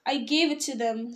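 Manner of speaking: plain reading voice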